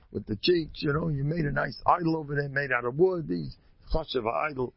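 usual speaking voice

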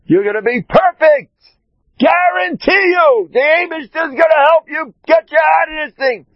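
high, loud shouted blessing into the phone; top-of-the-lungs shout of encouragement; screaming blessing. Retold or imitated. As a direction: yell it like a rebbe blessing a sick woman. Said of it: "I screamed at the top of my lungs".

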